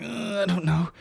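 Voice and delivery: high, faint groaned reply; imitation of a woman in labour; struggling labouring woman. Labouring partner struggling to answer.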